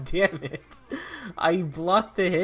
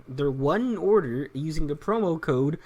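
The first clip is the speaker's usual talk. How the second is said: normal talking voice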